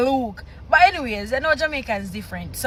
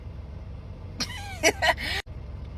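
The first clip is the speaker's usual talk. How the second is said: high squealing laugh; 11 dB quieter than usual. Real, not acted. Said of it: "if you no want to say that believe".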